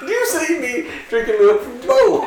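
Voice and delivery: high laughing mock rant; laughing mock cop line. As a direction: join the bit, shouting through laughter. Joins the cop imitation.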